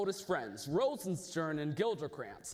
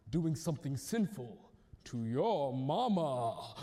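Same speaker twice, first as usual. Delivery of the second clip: lower, slow, sly insinuation; sly ghost; 6 semitones lower than usual. Acted. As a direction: drag it out knowingly. Ghost tells of the queen.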